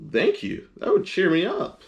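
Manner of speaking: grateful little pig; Piggy's voice. Acted.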